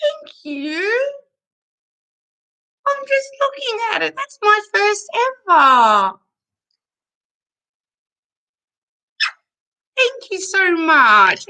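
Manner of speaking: high, slow, tearful thank you; real choked-up gratitude, voice near tears; emotional thanks. Real, not acted. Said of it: "I'm a bit emotional right now".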